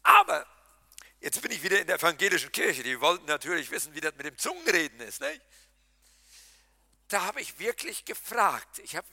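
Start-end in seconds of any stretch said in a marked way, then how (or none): none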